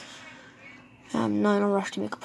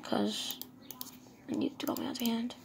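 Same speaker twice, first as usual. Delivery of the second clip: whisper; 11 dB quieter than usual. Real, not acted. Whispered aside while crafting.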